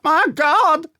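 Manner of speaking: sudden high, loud cry of alarm; frightened cry. Acted. Groom cries out as the dog leaps for his throat, high frightened shout. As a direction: yelp it out as the jaws come at you. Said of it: "the groom cried out".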